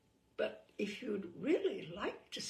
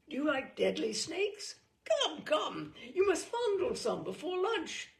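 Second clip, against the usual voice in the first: jolly patriarch; 6 semitones higher, 4 dB louder than usual. Acted. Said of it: "He's delighted to see you".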